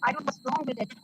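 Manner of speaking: ordinary talk, nothing marked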